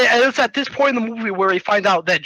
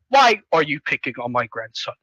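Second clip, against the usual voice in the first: low, lightly voiced old-man scolding; a hint of a stern elder; stern grandpa; 7 semitones lower than usual. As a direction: confront them in a lowered grandpa voice. Grandpa confronts the bullies.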